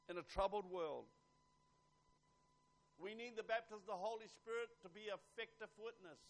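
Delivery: urgent declaration to the congregation; forceful preaching. Real, not acted. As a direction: preach it with punch.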